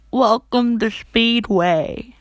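loud, slow cashier greeting; store greeter voice. Pretend. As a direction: announce it like a cashier.

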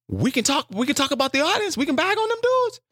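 high, excited insisting; climbing excitement, re-enacted; retold eager pitch. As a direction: pitch your voice way up, eager to jump in. Retold eager plea from the sideline.

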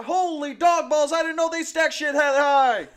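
high, loud, slow sergeant roar; drill sergeant. Retold or imitated. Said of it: "drill sergeants".

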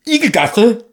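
high, loud puzzled Igelgasse; Harry's confused call. Retold or imitated. Quoting Harry's muddled fireplace call. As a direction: call out Igelgasse like a lost boy. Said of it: "dass Harry „Igelgasse“ sagt".

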